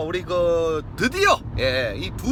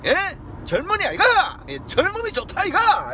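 high, loud Gyeongsang cheer; excited cheer; 14 semitones higher, 4 dB louder than usual. Real, not acted. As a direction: cheer him on loudly. Cheering the young man.

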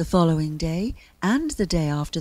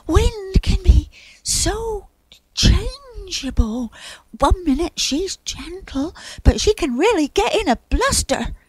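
warning cloud; 12 semitones higher, 5 dB louder than usual. A narrator's reading. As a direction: warn of someone's temper in a high register.

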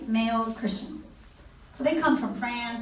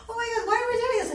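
much higher, rushed outburst; exasperated professor; 11 semitones higher, much faster than usual. Retold or imitated. Mimicking the professor's high, fast exasperation. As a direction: throw up your hands and blurt it out fast. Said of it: "He's like".

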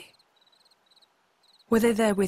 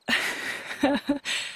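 awkward laugh; 6 semitones higher than usual. Acted. Awkward after the boy's blunt rebuff.

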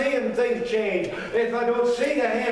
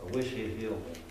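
barely voiced, far softer and lower; a quiet half-hearted wish; timid wish; 13 semitones lower, 11 dB quieter than usual. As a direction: mumble it meekly to yourself. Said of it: "just sat there and said".

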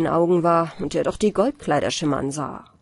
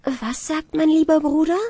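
put-on higher, innocent girlish question; young bride asking; 8 semitones higher than usual. A narrator's reading. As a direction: ask sweetly like a trusting young bride. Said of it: "Die Braut fragte".

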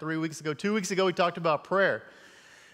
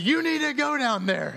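higher, louder firm order; wife insisting; 9 semitones higher, 4 dB louder than usual. Retold or imitated. Wife ordering husband down to the coaches, firm and louder. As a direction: leave him no way out. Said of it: "she said".

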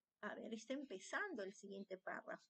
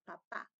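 "Papá" with weight on the final PÁ; "Papá" stressed at the end to show the accent; stress on last syllable; 5 semitones lower than usual. Shown as a demonstration. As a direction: say "Papá" low, landing firmly on the end. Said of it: "coloquen la tilde".

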